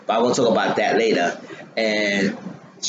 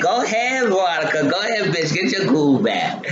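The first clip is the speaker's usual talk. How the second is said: high-pitched excited cheer, egging her on; 8 semitones higher than usual. Real, not acted. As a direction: hype her up like you're yelling at the TV. Speaker cheers on Veronica's move.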